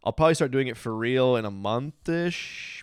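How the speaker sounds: ordinary talk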